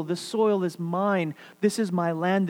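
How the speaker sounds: normal talking voice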